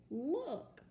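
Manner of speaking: high, loud excited Look; excited exclamation. Shown as a demonstration. Teacher showing kids how to read an exclamation mark. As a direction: say it like you just spotted something. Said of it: "so say it with excitement".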